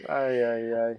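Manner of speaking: ordinary talk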